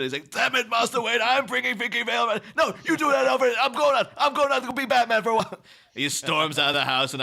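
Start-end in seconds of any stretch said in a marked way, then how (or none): 0.3-5.6 mock shouted quarrel between butler and master, much higher; mock argument. Friends retelling Alfred and Bruce fighting, mock shouting. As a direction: yell it like a butler and his master bickering.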